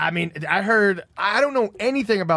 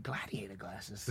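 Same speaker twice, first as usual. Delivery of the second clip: whispered, slow, puzzled question; hushed disbelief; 10 semitones lower, 22 dB quieter, slower than usual. Retold or imitated. Boyfriend querying girlfriend's gift, whispered disbelief. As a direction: drop to a very low whisper. Puzzled reply in retold chat.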